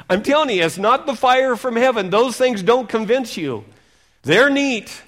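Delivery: loud, high fervent preaching; full-force revival preaching. Acted. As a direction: preach it out to the hall.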